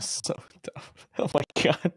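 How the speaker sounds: soft unvoiced whisper; whispered so dumb. Real, not acted. Friend hearing the knife story, whispered disbelief. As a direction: breathe it out in disbelief.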